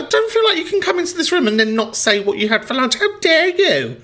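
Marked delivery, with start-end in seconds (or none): 3.0-4.0 high, slow, theatrical outrage; hammed-up high indignation; mock outrage. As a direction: gasp it like a scandalised aunt. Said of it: "lunch homophobia".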